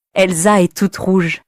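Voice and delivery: plain reading voice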